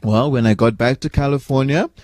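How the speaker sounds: usual speaking voice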